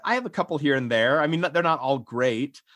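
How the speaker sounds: ordinary talk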